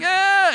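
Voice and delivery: high-pitched imitated baby wail "Ah"; baby's angry wail. Pastor imitates his son's angry cry for the congregation, high wail. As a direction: squeal it like a cranky infant. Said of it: "he started doing this angry cry".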